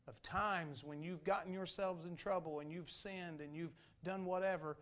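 usual speaking voice

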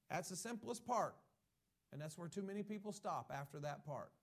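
ordinary delivery

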